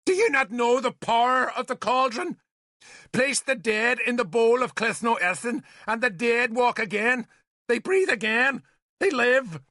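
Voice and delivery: high, fervent shouting. A narrator's reading. Nimue telling Arthur of the cauldron's power over noisy fires, shouting. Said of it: "She had to shout to be heard".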